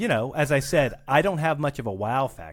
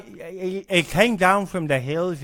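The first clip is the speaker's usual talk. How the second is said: put-on louder, hesitant stab at a Scottish accent; attempted Scottish accent; 3 semitones higher, 4 dB louder than usual. Guest tries Scottish on the host's request, unsure attempt. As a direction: have a shaky go at Scottish, breaking off. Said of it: "All right, a Scottish accent?".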